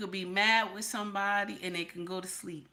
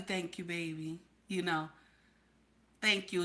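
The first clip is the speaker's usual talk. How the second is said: put-on a hushed, low "thank you, baby"; husband thanking his wife softly; soft grateful husband; 6 semitones lower, 9 dB quieter than usual. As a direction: play the contented husband, quietly.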